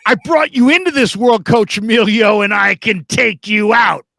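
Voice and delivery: over-the-top father scolding; mock stern father. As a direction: ham up an angry dad. Fan's mock father threat.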